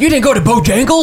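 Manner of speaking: high-pitched imitation of indignant kids; hammed-up offended question; mock outrage. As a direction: act out scandalized disbelief, voice jumping up. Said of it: "They were fucking pissed".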